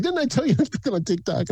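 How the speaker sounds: high, laughing imitation of the owner; mock taqueria owner. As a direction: laugh through a high teasing scold. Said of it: "he's like".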